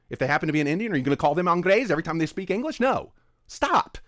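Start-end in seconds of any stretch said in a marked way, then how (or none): 2.8-4.0 sharp, higher, louder stop; exasperated. Real, not acted. Telling offended commenters to quit, exasperated louder stop. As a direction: shut them down, fed up.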